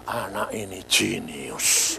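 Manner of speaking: teacher marvelling quietly at the pupil; awed teacher. Retold or imitated. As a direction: say it slowly in hushed wonder. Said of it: "Dan dia bilang apa?".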